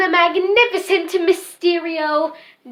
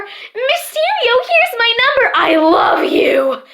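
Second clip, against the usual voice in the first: high squeal building to a loud cry; shouting adoring fan; 6 semitones higher, 4 dB louder than usual. Acted. Magician voices a fan at his show, shouting adoringly. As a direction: imitate a swooning fan calling to the magician. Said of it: "No one to shout".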